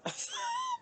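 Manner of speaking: squealing giggle; high squeal laugh. Real, not acted. Laughing at herself.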